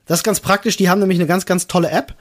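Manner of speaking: ordinary talk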